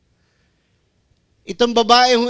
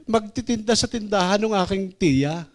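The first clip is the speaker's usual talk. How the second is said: put-on confessing girl; 5 semitones higher than usual. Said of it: "Sabi niya".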